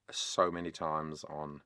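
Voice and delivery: ordinary talk, nothing marked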